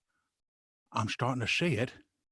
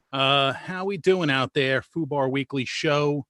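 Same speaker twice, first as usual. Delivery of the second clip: ordinary talk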